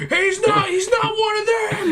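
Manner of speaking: high, loud cry of alarm; mock outraged shout. Onlookers exposing a kid without a football jersey, mock outraged shout. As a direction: raise the alarm in hammed-up horror. Staff spot a non-athlete, outraged.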